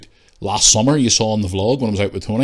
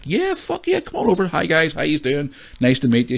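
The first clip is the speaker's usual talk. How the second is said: excited; 8 semitones higher than usual. Retold or imitated. His own delighted reply to fans.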